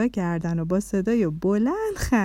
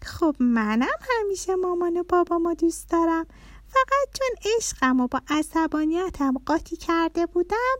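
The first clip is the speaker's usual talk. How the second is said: put-on high, cheerful little-girl voice; happy child; 8 semitones higher than usual. A narrator's reading. Little girl telling father she loves her parents, high and happy. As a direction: happily explain in a high child's voice. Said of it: "با خوشحالی گفت".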